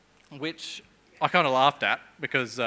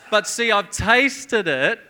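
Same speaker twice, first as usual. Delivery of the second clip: laughing; 6 semitones higher, 9 dB louder, slower than usual. Real, not acted. Laughing about the lamb.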